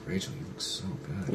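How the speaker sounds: soft, breathy "you look so good"; low hushed compliment. Retold or imitated. Teasing Rachel with a hushed, laughing compliment. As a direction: murmur it half-laughing. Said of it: "coulda used that low voice".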